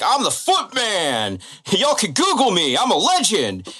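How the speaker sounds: high, drawn-out mock bragging; imitating a show-off's excited boast; excited boasting Shannon. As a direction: proclaim it like a hammed-up self-styled celebrity. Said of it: "he is so excited".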